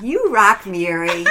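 loud, jubilant self-praise; self-cheering. Retold or imitated. Mary congratulating herself for asking for the blue glass, jubilant. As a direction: cheer yourself on with a big burst.

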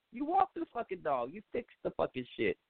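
ordinary talk

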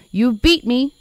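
loud, high accusing cries; high-pitched, forceful outburst; screaming patient. Acted. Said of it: "another screaming at some nurse".

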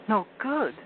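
high, alarmed question; startled and scared; frightened girl. A narrator's reading. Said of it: "feeling rather frightened".

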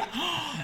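sharp high gasp of shock; mock gasp. Reacting to the wife's speech with a gasp. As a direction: gasp as if scandalized. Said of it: "I was like".

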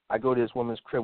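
ordinary talk

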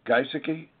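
"Gaisaki" with a questioning rise; unsure pronunciation check. Real, not acted. Host checks a case name with guest, unsure pronunciation. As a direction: try "Gaisaki" tentatively, lifting the end as a question. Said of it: "did I say that right?".

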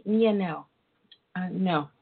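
usual speaking voice

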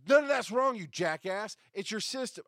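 high, loud furious groan and outburst; angry outburst. Retold or imitated. Podcaster retelling his inner reaction to the sound guy, furious. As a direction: explode at the jackass, high and loud. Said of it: "which goes".

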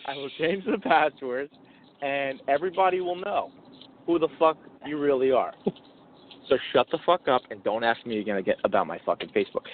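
low, measured mock menace; menacing pretend threat. Pretend husband threatening his wife over Facebook, menacing. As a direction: spell out each threat in a low voice.

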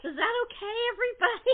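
a high, loud sing-song plea to the group; high mock question. Director asking the cast if the take worked, high and loud.

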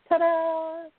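high, drawn-out ta-da; mock triumphant. Wife showing where clean laundry is, mock triumphant. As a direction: present it like a little magic reveal. Mimes a simple triumphant reveal.

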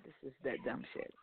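low and soft under the breath; muttered aside. Retold or imitated. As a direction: mutter it low, almost to yourself. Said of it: "I said".

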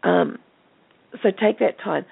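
usual speaking voice

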